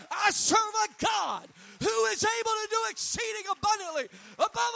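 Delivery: high, loud shouted preaching; fiery preacher shouting to the congregation; full-force preaching. Acted. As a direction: preach it out to the whole church.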